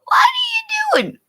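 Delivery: very high squeaky mock shock; hammed-up surprise at the doctor; mock astonishment. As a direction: squeal it like you can't believe it. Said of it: "and I'm going".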